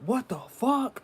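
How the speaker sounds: high, loud startled outburst; driver's shocked yell. Retold or imitated. The driver reacts to the crash up the street, shocked yell.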